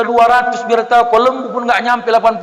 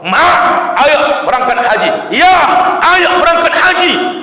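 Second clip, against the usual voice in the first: loud call; 7 semitones higher, 4 dB louder than usual. Acted. Child invites mother on pilgrimage.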